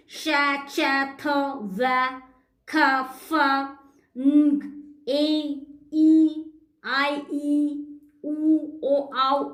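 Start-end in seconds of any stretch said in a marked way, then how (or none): 0.1-4.6 each digraph sounded out separately as "sha", "cha", "tha", "va"; phonics-teacher recital of "sha, cha, tha" sounds. Shown as a demonstration. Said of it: "P H means".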